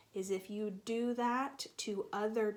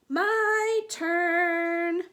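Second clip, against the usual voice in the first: high, loud demanding yell; grabby shout; 9 semitones higher, 14 dB louder than usual. Acted. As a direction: shout it like a kid snatching a toy. Sister grabs the toy.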